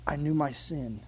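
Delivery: low, soft admission of guilt; sinking into a guilty hush; hushed confession. Acted. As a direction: confess it almost in a whisper, ashamed. Voicing Jonah's guilty confession.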